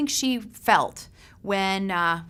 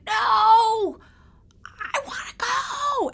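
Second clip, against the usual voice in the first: very high, slow, tearful wail; crying plea; 14 semitones higher, 5 dB louder, much slower than usual. Retold or imitated. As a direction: beg your husband not to turn back. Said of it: "I was like".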